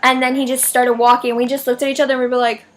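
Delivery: normal talking voice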